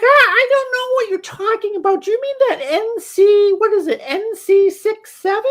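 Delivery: high falsetto mom, loud and rattled; spiraling mom. Retold or imitated. Confused mom questioning her son, spiraling falsetto. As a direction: imitate a mother starting to panic. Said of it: "she's kinda, like, spiraling".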